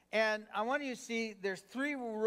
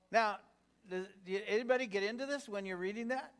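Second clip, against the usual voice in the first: usual speaking voice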